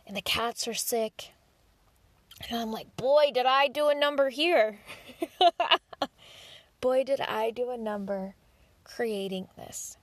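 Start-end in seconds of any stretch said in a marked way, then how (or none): 3.0-4.8 big rueful outburst, pitched up; loud rueful exclamation. Retold or imitated. Reacting to her own bad choice, loud and rueful. Self-mocking realization. 4.8-6.1 short high laugh at her own mistake; rueful laugh. Real, not acted. Laughing at her own bad choice, loud and rueful.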